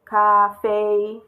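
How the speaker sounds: 咖啡 held level and loud on both syllables; two flat tones. Shown as a demonstration. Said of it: "the two flat tones will be pronounced flatly".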